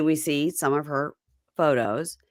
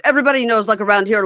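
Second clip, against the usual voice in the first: loud mock insistence; 3 semitones higher, 7 dB louder, much faster than usual. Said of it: "And Andre goes".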